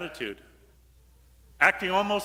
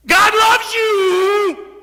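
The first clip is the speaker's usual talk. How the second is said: much higher, much louder, slow ecstatic cry; joyful shout; 13 semitones higher, 13 dB louder, much slower than usual. Shown as a demonstration. Preacher showing the congregation how it should sound, a huge slow shout. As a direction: shout it out like fire. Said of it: "But no, that's not it".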